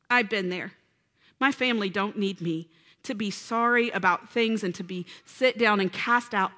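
normal talking voice

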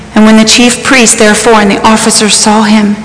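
ordinary talk, nothing marked

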